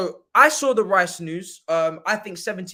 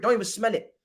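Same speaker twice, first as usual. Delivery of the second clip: usual speaking voice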